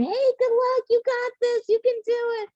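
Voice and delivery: high, bright cheer of a stranger at the roadside. Retold or imitated. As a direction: call out encouragement to passing runners.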